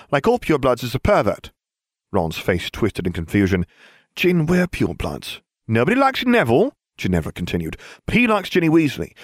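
a hint of a brisk presenter; lightly voiced Ginevra briefing. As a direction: lay out the gossip like a report. Ginevra presenting relationship web.

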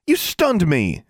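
high-pitched accusing shout; indignant shout. A narrator's reading. Girl accusing the custodian, shouting. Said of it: "Alexandra shouted".

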